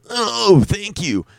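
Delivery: high squeaked mock ecstasy; O face thanks. Comic shows the customer's O face, high squeak. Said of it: "show my O face".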